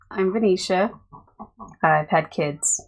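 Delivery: subdued, sad-sounding introduction; depressed. Real, not acted. Wife introducing herself on the podcast, depressed. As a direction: introduce yourself with no cheer, a little sad. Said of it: "She sounds so depressed".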